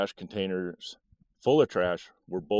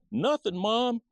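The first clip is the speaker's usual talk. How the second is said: put-on innocent little boy; 14 semitones higher, slower than usual. Boy answering his mom from the basement, high and innocent. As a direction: play the kid hiding what he did.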